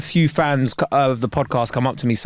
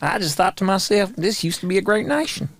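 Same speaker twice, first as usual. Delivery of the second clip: mock American accent; 4 semitones higher than usual. Playing a local mourning the nation, mock American accent. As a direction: lament in a put-on American twang. Same redneck character continues.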